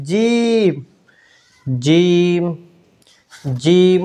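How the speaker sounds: জিম said hard and firm. Shown as a demonstration. Teacher modelling a hard জিম for students. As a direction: press জিম out strongly. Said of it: "জিম শক্ত হবে".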